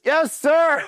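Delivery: high, loud strained shout; comically high mock holler; recruit sounding off. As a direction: imitate a recruit calling across the barracks. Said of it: "from across the fucking squad bay".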